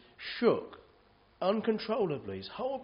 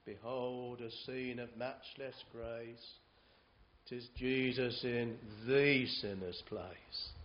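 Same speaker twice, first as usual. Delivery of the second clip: slow, hushed, lower reverent verse; hymn recitation; 4 semitones lower, slower than usual. Acted. To the congregation, the preacher recites a hymn slowly, hushed. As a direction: let it fade almost to a whisper at the end.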